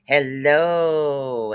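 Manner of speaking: a long, cheery sung-out greeting; drawn-out singsong hello. Real, not acted. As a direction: stretch the hello out long and falling. Greeting a viewer in live chat.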